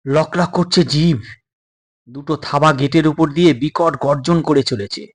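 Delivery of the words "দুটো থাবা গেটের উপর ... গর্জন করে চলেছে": ordinary delivery, nothing marked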